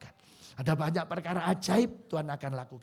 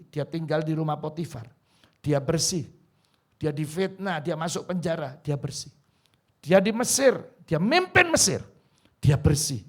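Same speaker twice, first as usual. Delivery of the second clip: preaching at full force; 8 dB louder than usual. Acted. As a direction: proclaim it to the congregation. Preacher building intensity. (berkhotbah dengan lantang dan bersemangat)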